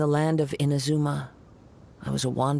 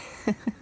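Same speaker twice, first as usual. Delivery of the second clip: brief soft chuckle; 9 semitones higher, 4 dB quieter than usual. Acted. Samurai amused by the question.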